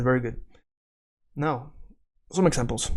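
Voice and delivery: ordinary delivery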